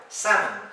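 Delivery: "Salmon" as SAM-un; correct pronunciation. Shown as a demonstration. Teacher repeating the word for learners, correctly pronounced. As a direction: say "Salmon" with a silent L. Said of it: "La L no se pronuncia".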